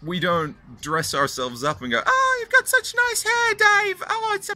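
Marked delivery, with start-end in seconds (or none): 2.1-4.5 very high, loud falsetto gush; gushing squeal. Acted. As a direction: squeal a mock compliment, way over the top. Mock gushing compliment between men.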